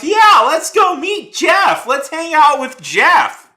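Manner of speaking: slow, very high, loud sneering mimicry; mock him like an idiot; drawn-out squealing parody of a fool; mocking quote. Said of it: "And you're like".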